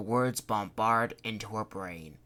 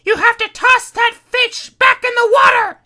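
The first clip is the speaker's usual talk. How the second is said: screaming; 19 dB louder than usual. Acted. Kitty keeps screaming.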